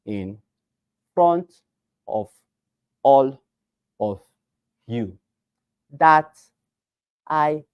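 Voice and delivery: exaggerated word-by-word crawl with long gaps; counting each word. Shown as a demonstration. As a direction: say one isolated word, pause, then the next. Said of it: "you should literally count your words".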